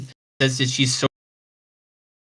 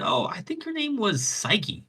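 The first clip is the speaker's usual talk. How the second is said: lightly voiced seagull, higher; 12 semitones higher than usual. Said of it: "And he said".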